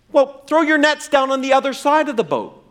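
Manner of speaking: high-pitched call across water. Retold or imitated. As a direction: call instructions out to the boat. Jesus calls to fishermen offshore.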